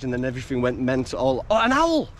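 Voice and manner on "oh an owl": surprised. Real, not acted. Spotting a bird in the woods.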